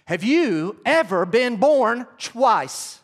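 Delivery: each word slow and weighty; drawn-out searching question to the congregation; slow pointed question. Real, not acted. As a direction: ask it slowly, pressing the point home. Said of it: "So I ask you this morning".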